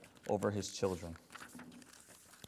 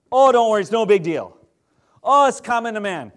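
higher, louder airy brush-off; mocking dismissiveness; 6 semitones higher, 9 dB louder than usual. Preacher mocking people who shrug off sin, airy. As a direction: mimic a careless excuse-maker. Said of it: "mocking dismissive attitude".

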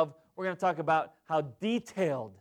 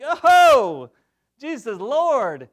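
naive delight; 7 semitones higher, 10 dB louder, slower than usual. Retold or imitated. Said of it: "we think".

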